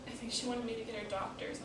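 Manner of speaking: hushed account of a parent's death, heavy pauses; grieving daughter. Acted. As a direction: let it sink quietly. Monologue, recalling mother's death.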